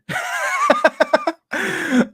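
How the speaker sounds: high shrieking laugh, cut short; burst of laughter. Real, not acted. Laughing at the chat reply, high laughing burst. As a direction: break out laughing at the message.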